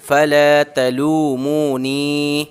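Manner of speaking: very slow फला तलू मू नी, ला, मू, नी held long; slow recitation; stretched vowels. Shown as a demonstration. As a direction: hold ला, मू, नी, keep फ and त short. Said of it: "पूरी line से खींच रहे हैं".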